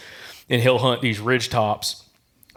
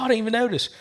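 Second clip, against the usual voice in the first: higher mock-surprised local; imitating local hunter; 11 semitones higher, faster than usual. Local hunter admits he missed the accent, higher voice. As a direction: play the local's surprise up high. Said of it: "He's like".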